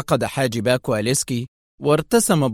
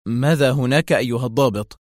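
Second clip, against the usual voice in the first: ordinary delivery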